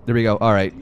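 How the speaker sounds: ordinary delivery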